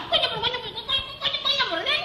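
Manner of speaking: soft, high-pitched repetition; tiny comic falsetto-like voice; small high voice. Acted. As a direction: squeak it softly, word after word. Acted aside in a comic scene.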